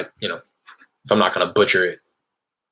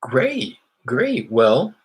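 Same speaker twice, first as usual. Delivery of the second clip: bright, higher salesman voice on a cold call; cold-call voice, higher and eager; upbeat phone pitch; 8 semitones higher than usual. Real, not acted. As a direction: sound friendly and casual to a stranger.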